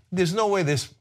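usual speaking voice

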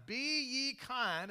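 loud, drawn out Be ye kind; emphatic command. Shown as a demonstration. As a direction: proclaim it from the pulpit. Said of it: "the scripture says that, that we are to be kind".

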